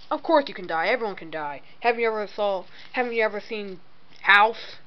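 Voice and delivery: normal talking voice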